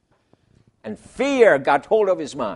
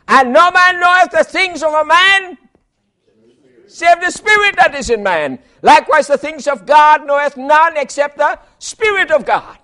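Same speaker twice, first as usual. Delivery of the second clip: high, loud proclaiming of scripture; preaching scripture; 10 semitones higher, 9 dB louder than usual. Acted. Preacher proclaiming scripture to the church, high and loud. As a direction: thunder the verse out to the congregation.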